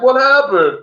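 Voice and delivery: high startled what happened; mock confused wake-up. Mimicking the coughing comic waking up, high and startled. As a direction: play the dazed guy waking up.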